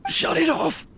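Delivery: high, strained urgent cry; desperate urgent cry. Acted. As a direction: force the order out in panic. Urgent plea during heart attack.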